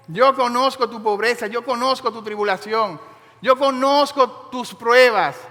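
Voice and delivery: rising, fervent pulpit delivery; impassioned sermon voice; full-force preaching. Acted. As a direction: preach it out to the whole church. Preacher exhorting the church.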